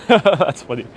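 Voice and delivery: real laughter running into the words; chuckle, then say it amused; laughing. Real, not acted. Laughs at a viewer's joke.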